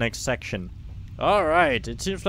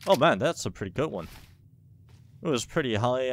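ordinary talk

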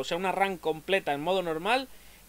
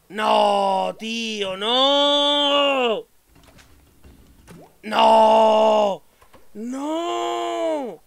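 loud, higher furious screams; raging gamer; 5 semitones higher, 11 dB louder, much slower than usual. Real, not acted. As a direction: scream no at the screen. Loses to the boss, screaming.

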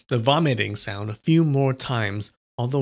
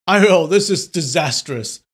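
louder mock-alarmed cry; shouted aiyo; 3 semitones higher, 6 dB louder than usual. Drunk pretends to stumble when pushed, loud cry. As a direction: yelp as if knocked off balance.

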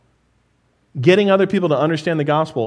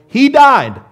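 sudden loud emphasis; 6 semitones higher, 7 dB louder than usual. Acted. Climax of accident story.

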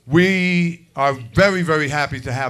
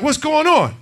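startled; 10 semitones higher than usual. Retold or imitated. Father catching son.

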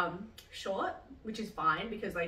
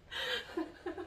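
high, soft giggling laugh; amused laugh; 10 semitones higher, 6 dB quieter than usual. Real, not acted. Laughing about needing ultrasound to find veins, real giggle. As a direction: break into a light, high-pitched laugh.

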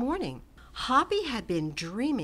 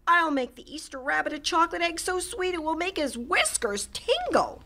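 higher, rising to a squeaky tingle; excited bunny; 9 semitones higher, 4 dB louder than usual. A narrator's reading. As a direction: dream out loud, thrilled. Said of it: "Hoppy said".

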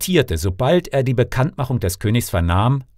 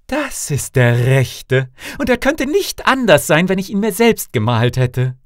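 high, raised voice of a delighted princess; princess exclaiming; 7 semitones higher, 4 dB louder than usual. A narrator's reading. Daughter on sighting the suitor her father found, high exclamation. Auch sogleich ausrief.

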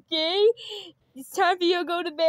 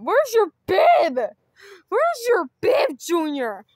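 loud demanding; 3 semitones higher, 8 dB louder than usual. Acted. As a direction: yell for the bib. Dad demanding bib.

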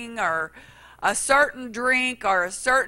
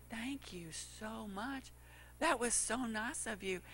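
sheepish thanks; 16 dB quieter than usual. Retold or imitated. Preacher thanking the cashier softly, sheepish. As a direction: mumble it, sheepish.

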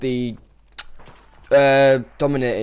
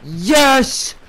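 rising, louder cheer of joy; excited yes; 10 semitones higher, 5 dB louder than usual. Retold or imitated. Player nearing a killstreak, cheering higher and louder. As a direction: celebrate getting close to helicopters.

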